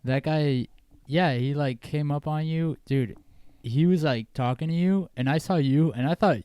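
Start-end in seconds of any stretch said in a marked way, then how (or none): none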